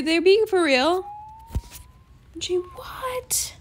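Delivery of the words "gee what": soft, voiceless gasp of disbelief; barely-there whispered amazement; stunned whisper. Real, not acted. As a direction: whisper it in shock. Still stunned by the gift.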